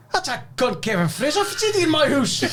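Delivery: high-pitched retold indignation; mock outrage. Man spotting Kevin Fraser in his house, high mock outrage. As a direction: exclaim it as an incredulous complaint.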